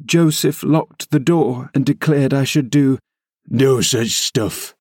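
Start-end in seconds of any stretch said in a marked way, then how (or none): 3.5-4.8 put-on gruff old servant. A narrator's reading. Joseph's quoted words.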